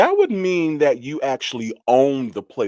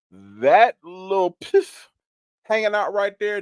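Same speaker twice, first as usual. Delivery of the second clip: mocking emphasis; 12 semitones higher, 4 dB louder than usual. Real, not acted. Mocking a rival's hair.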